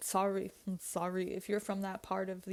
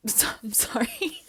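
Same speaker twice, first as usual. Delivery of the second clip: giggly apology; 4 semitones higher, 5 dB louder than usual. Real, not acted. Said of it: "That's so mean".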